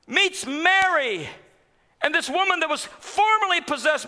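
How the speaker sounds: high, ringing sermon voice; rising pulpit fervor; impassioned preaching. Acted. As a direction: preach it up to the rafters. Preaching at full force.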